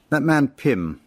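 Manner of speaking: a hint of a blustering army captain; pompous captain. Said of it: "a captain was heard to say".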